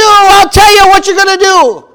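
loud, high-pitched mock mob boss laying down the law; lightly voiced stern Godfather. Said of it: "Just like the Godfather said".

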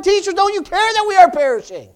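high, loud desperate cry to the teacher; panicked plea. Retold or imitated. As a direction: cry out as if drowning. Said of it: "You ever gone to God like that?".

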